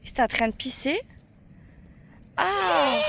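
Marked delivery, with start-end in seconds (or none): 2.4-3.0 sudden high startled cry; surprised shriek. Real, not acted. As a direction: yelp of shock at the animals. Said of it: "Oui, c'est bon, je me calme".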